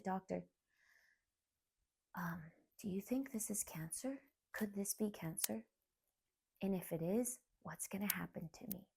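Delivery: very soft, near-whispered question; hushed question. Retold or imitated. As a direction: retell it hushed, as if afraid to ask the doctor. Retelling fearful question to doctor.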